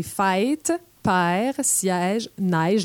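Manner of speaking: «fête», «père», «siège», «neige» with long, diphthonged vowels; Quebec diphthongs. Shown as a demonstration. Teacher shows Quebec long vowels slowly. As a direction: draw the vowels out and glide them, slowly. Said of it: "nos fameuses voyelles longues".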